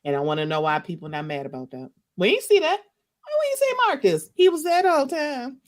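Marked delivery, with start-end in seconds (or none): 2.2-5.6 indignant outburst. Real, not acted. Indignant rant about Marcus.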